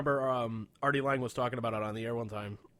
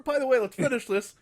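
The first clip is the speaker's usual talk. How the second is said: high, loud announcement; mock abrupt interruption; 10 semitones higher, 9 dB louder than usual. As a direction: blurt it loudly as a sudden change of course. Mock abrupt swerve back to story.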